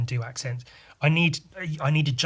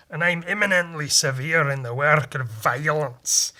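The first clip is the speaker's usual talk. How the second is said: broad, rolling Scottish brogue; emphatic stage-Scottish accent; 4 dB louder than usual. Acted. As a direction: declaim it as a proud Scot. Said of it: "Or if it was Scottish".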